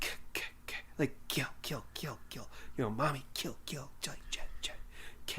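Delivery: eerie breathy whisper of kill and ch-. Shown as a demonstration. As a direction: whisper it like the killer's echo. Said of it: "Jason Voorhees is supposed to be".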